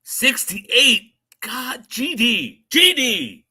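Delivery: high, loud mock shock; surprised. Real, not acted. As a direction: act stunned at the number. Shocked at episode count.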